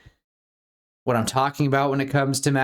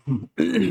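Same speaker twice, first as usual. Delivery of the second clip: cough; 8 semitones higher than usual. Real, not acted. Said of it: "Coughing break".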